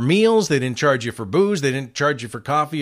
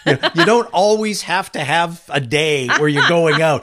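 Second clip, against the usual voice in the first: a hint of an exasperated program director, higher and louder; exasperated boss; 5 semitones higher, 3 dB louder than usual. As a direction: lightly play the fed-up boss scolding. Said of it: "who said".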